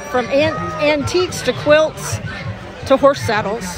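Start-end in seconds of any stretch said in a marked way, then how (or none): none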